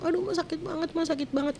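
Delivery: high, pleading child voice, imitated; child in pain. Child in pain complains to mother.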